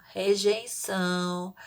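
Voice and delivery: slow, blocked-nose voice; drawn-out word through a pinched nose; nose pinched shut. Shown as a demonstration. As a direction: say it holding the nose closed. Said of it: "Tampa com os dois dedinhos e fala".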